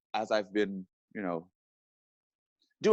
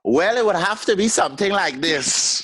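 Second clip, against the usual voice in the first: high, louder Caribbean-style accent with a laugh; put-on accent; 12 semitones higher, 7 dB louder than usual. Shown as a demonstration. Asked for his best accent, he shows it. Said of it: "What's your best accent?".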